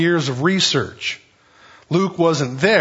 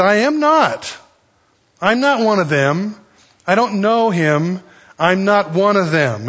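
high-pitched, insistent acted denial; emphatic denial; 5 semitones higher, 3 dB louder than usual. Peter denying Jesus to his accuser, emphatic denial. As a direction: deny it firmly, pitch jumping up.

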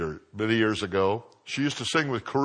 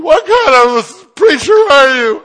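indignant woman; 14 semitones higher, 12 dB louder, slower than usual. Retold or imitated. Offended woman retorts to preacher.

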